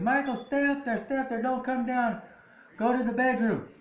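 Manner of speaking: high, insistent retold order; high-pitched imitation of a frantic warning; urgent mock calling. Retold urgent calling to child upstairs.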